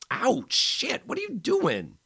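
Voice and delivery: very high, loud cry of pain; hurt man crying out in pain; pained yelp. A narrator's reading. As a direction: yelp as the sore ribs are pressed. She presses his ribs.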